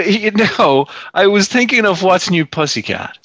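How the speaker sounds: normal talking voice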